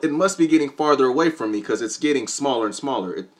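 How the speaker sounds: normal talking voice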